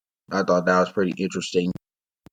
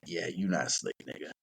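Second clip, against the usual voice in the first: quiet aside; 6 semitones lower, 12 dB quieter than usual. Retold or imitated. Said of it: "And I'm like".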